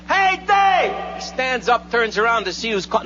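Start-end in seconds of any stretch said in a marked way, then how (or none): 0.1-1.0 high, long-held holler; distant bleacher shout. Acted. Fan yells from the bleachers, high distant shout. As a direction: call it out as if from far up in the stands. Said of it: "Here comes the voice again".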